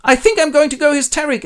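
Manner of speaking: father rejected by his daughter; hysterical old king. Acted. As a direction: fall apart in a shriek. Lear rejected by Regan.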